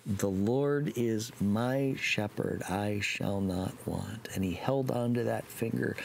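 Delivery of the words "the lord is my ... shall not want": slow and deliberate, each word weighed; slow, meaningful recital. Retold or imitated. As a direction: say it slowly with meaning. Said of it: "Timmy practiced this psalm".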